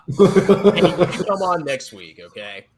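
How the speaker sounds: slow, loud, laughing. Real, not acted. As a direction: tease him through a laugh. Laughing, teasing Nate.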